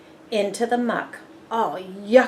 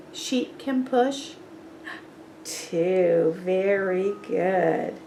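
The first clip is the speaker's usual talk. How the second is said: plain reading voice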